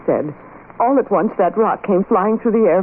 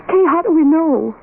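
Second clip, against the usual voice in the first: urgent; 8 semitones higher than usual. Acted. Pressing the eerie question.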